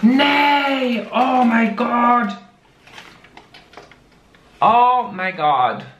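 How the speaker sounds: gasping surprise. Real, not acted. To the sender, opening the box, high shocked gasp.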